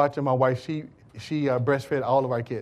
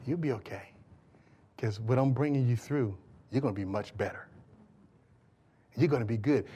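God's calm reassurance; 2 semitones lower, 5 dB quieter than usual. Acted. Speaking as God to panicking believers, calm and soft. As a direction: settle them gently, completely unworried. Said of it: "God just sit there and say".

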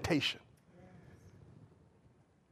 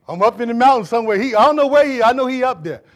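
loud, raised, quick delivery; calling out; 3 semitones higher, 12 dB louder than usual. Acted. Pastor telling those looking for him, calling out. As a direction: burst out louder and higher. Calls out about being up mountain.

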